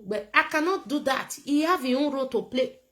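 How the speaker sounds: ordinary talk, nothing marked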